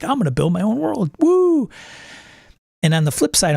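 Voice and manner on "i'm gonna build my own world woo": eager, rising cheer on world then a high whoop; gleeful about building a whole world; excited world builder. Retold or imitated. Game master gushing about building his own world, excited. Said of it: "Part of the game master piece is like".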